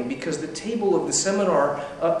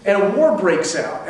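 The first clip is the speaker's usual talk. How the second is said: ordinary talk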